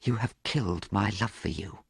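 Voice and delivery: soft, hushed accusation; hushed and wounded; whispered. A narrator's reading. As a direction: say it softly, heartbroken. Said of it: "he whispered".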